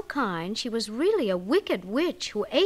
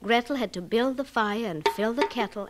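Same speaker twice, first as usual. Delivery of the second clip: usual speaking voice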